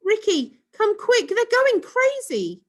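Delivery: high, loud call from upstairs. A narrator's reading. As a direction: call him urgently from far. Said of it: "Sue called from an upstairs window".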